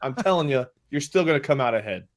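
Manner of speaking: ordinary talk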